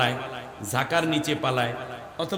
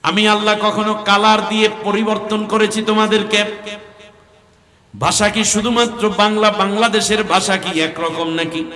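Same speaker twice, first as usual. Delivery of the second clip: high, loud challenging questions; rhetorical sermon questions; 7 semitones higher, 6 dB louder than usual. Acted. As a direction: throw the question at the crowd. Preacher asking the crowd. (উঁচু গলায় শ্রোতাদের প্রশ্ন ছুড়ে দেওয়া)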